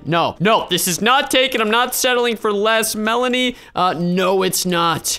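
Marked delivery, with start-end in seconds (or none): none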